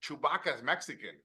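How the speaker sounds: put-on quick-witted dad with a Mexican accent. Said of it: "he goes".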